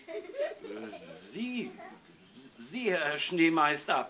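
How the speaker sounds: high, hesitant stutter with pauses; nervous, halting reply; stammering. A narrator's reading. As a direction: stumble over the words, cowed. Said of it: "stotterte der Gefängnisdirektor".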